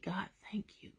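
soft unvoiced whisper; whispered prayer. Real, not acted. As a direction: breathe the thanks to God.

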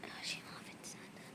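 soft unvoiced grumble; whispered mutter. Real, not acted. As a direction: grumble it under your breath. Muttering after failing.

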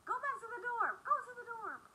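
high, panicked urging; frantic orders. Retold or imitated. Scared friends telling the driver to head to the dorm, high and frantic. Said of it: "Like, we was".

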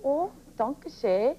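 a light, slow attempt at a Swedish accent; mock Swedish lilt, barely there. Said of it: "using a Swedish accent".

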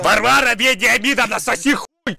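furious yell at the screen; raging gamer. Real, not acted. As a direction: scream it straight at the chat. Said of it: "он кричит в чат".

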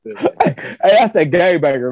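imitating him while cracking up; laughing retold quote. Cracking up while quoting the brother.

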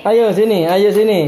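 calling over. Real, not acted.

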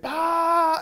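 loud, high, sustained "bah"; big held shout of infinity; explosive cosmic blast. Retold or imitated. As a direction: burst open on one long high note. Voices the infinite as sound.

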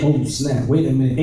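ordinary delivery

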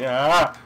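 sudden loud "ah"; startled yell. Real, not acted. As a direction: yell out when caught off guard. Attacked while claiming to be safe.

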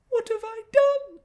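put-on a hurt child protesting; sobbing girl. A narrator's reading. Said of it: "sobbed she".

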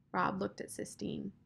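usual speaking voice

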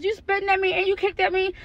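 ordinary delivery

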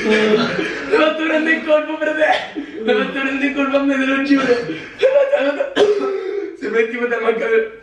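high, laughing through the words. Real, not acted. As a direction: giggle while teasing him. Laughing after cold water prank.

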